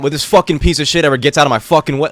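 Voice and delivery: sudden loud high cursing at a driver; road rage outburst. Retold or imitated. Switching from a sweet call to cursing a driver, furious yell. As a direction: explode at the car in your way.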